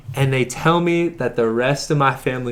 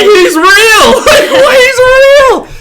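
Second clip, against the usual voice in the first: high, loud, laughing through tears; laughing joyful cry; 11 dB louder than usual. Retold or imitated. Retelling the phone call with his aunt, laughing and crying. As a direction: shout it out laughing and crying at once. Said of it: "I'm like".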